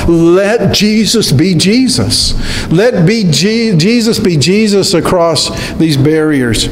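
ordinary talk, nothing marked

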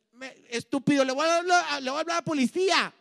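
high, loud outraged yell; angry shout. Retold or imitated. Offended woman yells at the drunk she'll call the police, shouting. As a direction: yell at him to get lost, outraged.

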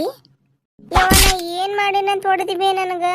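put-on high, slow sobbing child; child crying. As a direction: wail it out through tears. Child cries after beating. (ಅಳುತ್ತಾ ಮಗುವಿನ ಧ್ವನಿ)

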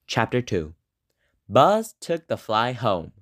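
ordinary delivery, nothing marked

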